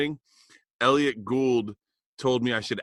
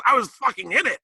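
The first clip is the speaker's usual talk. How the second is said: high, loud offended outburst; hammed-up indignant squawk; mock indignation; 11 semitones higher, 5 dB louder than usual. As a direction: protest at top pitch as the overlooked actor. Bill's indignant reply, retold.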